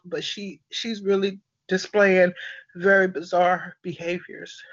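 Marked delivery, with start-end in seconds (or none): none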